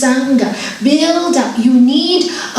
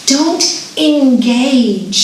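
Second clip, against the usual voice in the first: slow emphatic; much slower than usual. Real, not acted. Teacher driving home a point.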